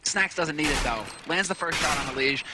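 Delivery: normal talking voice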